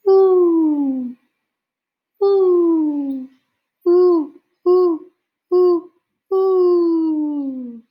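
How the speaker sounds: high, loud hooting owl call. Acted. Father calling to the owl in the woods, loud high hoots. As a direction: hoot out long like a great horned owl. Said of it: "Then he called".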